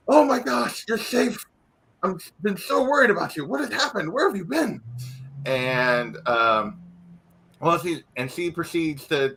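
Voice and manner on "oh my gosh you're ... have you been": higher, relieved father voice; a father who has feared the worst; city guard commander embracing his daughter, relieved; worried father. Acted.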